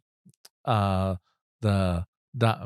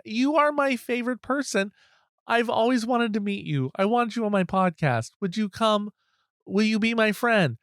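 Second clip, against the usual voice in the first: gushing fan; 8 semitones higher than usual. Retold or imitated.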